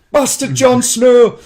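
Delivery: hammed-up proclamation of bastard Jon Snow; mock grand shout. Announcing Jon Snow grandly to a podcast guest.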